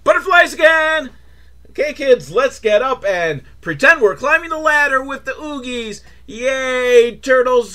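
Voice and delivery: put-on high, hyped-up children's show leader; rally the kids with fake cheer; mock peppy kids' cue. Mimics cue for children to join in.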